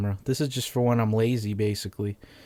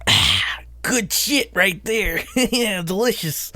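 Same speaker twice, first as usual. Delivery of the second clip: mock savoring; 8 semitones higher, slower than usual. Savoring a drink, high odd voice.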